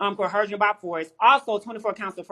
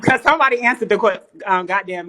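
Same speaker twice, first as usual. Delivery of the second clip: loud interruption; 2 semitones higher, 9 dB louder than usual. Real, not acted. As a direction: shout over the other talkers. Cuts across others talking over her.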